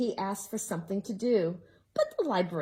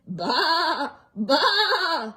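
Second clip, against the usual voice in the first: loud laughing baa; bleating goat; 5 semitones higher, 6 dB louder than usual. Acted. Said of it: "all she heard was".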